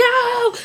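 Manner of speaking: high, loud yelp of pain; mock scream as the leg is moved; pained cry. Acted pain pulling pants off.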